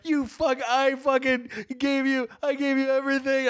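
high, wounded angry rant; retold yelling. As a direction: act out yelling without much volume. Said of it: "I'm yelling at her".

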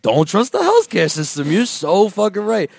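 mock enthusiastic echo, pitch rising; eager agreement. Girlfriend agreeing with her new best friend, mock eager echo. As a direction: echo it as if suddenly convinced.

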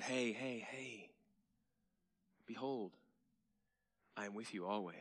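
soft, hushed reassurance; tender Jesus. Retold or imitated. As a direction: gently comfort the worried disciples. Jesus comforting worried disciples.

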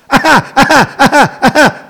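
high, loud repeated ha-ha bursts; loud staccato laugh. Real, not acted. Host beating listeners to his own joke, loud staccato laugh. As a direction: laugh loudly in evenly spaced bursts.

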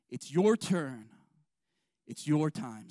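slow, punched "It's your turn" with weight on "your"; leading the refrain. Shown as a demonstration. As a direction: lead the church in the refrain, stressing "your". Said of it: "Say that with me".

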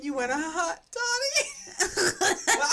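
high, drawn-out wail breaking into laughter; mock lyric wail. Friend mocking a pop lyric, high drawn-out wail. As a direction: belt it slowly, far too high.

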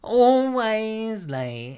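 very high, loud, slow mock wail; exaggerated drawn-out echo. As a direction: stretch it out like a dramatic whine. Echoing co-host in exaggeration.

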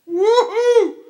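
loud, very high-pitched woo-hoo; piercing celebratory holler; jubilant whoop. Real, not acted. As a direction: whoop for joy at full voice. Rejoicing at being reconciled.

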